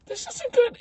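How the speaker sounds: very high squeaky worry; mock alarm. Visitor spotting clown at mirror, rising mock alarm. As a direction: let the pitch climb in dread.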